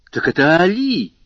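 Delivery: loud, high cry of recognition; surprised exclamation. A narrator's reading. Young man suddenly realises who the servant is, surprised exclamation. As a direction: burst out with it, pitched well up. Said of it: "воскликнул Альбер среди всеобщего удивления".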